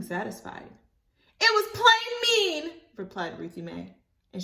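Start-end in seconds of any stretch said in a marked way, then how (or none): 1.4-2.8 much higher, slow, louder; hurt child retort. Acted. Ruthie Mae scolding Libby, high and slow. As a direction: say it sharply.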